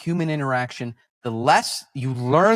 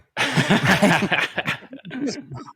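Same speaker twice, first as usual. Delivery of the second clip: laugh; 7 semitones higher than usual. Real, not acted. Laugh mid-story.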